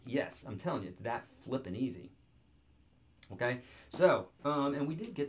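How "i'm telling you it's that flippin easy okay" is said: normal talking voice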